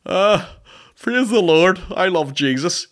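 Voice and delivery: loud, high put-on worshipper voice; hammed-up religious fervour, mocking; mock pious convert. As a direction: parody a gushing churchgoer. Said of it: "not, not like".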